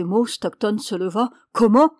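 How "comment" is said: loud, startled indignation, voice raised; outraged exclamation. A narrator's reading. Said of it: "s'écria-t-il". (exclamation indignée)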